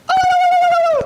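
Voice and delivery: very high, loud, sustained warbling cry; mock baby yell. Dreamed newborn pokes his head out, mock baby yell. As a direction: let out a long shrill wavering holler.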